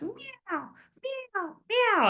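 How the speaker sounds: high, drawn-out mock meows; imitated cat meows. Vet advice host imitating a cat at night, mock meowing. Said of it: "It can be annoying when your cat's".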